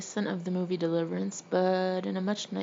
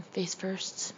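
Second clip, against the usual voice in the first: usual speaking voice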